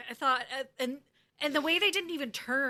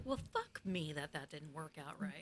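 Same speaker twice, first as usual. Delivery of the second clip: low Margot mutter; 7 semitones lower, 11 dB quieter than usual. Retold or imitated.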